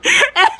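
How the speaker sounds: helpless squeaky laughter; high-pitched laugh. Real, not acted. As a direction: crack up at your own story. Cracking up at own story.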